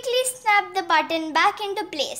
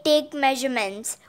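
plain reading voice

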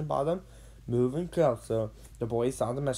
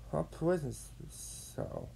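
ordinary delivery, nothing marked

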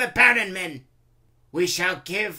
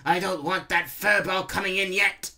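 raised, forceful commander's voice; ordering; 7 semitones higher, 8 dB louder than usual. A narrator's reading. Randolph to the gatekeeper about the furball, ordering loudly. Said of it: "Randolph ordered".

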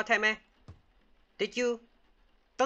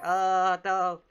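loud, drawn-out mock cry; raised, louder put-on wail for the baby; mock baby protest; 5 semitones higher, 8 dB louder than usual. Player voicing the baby's reaction, mock protest.

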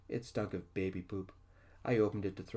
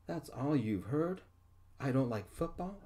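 incredulous yell; 6 semitones higher than usual. A narrator's reading. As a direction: throw the words back at him, higher. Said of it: "I yelled".

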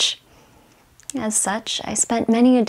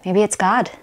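ordinary delivery

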